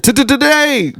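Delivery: high-pitched stuttered jeer; mock impatient heckle. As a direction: heckle the stumbler in a shrieky put-on voice. Mock quote heckling a stumbled word.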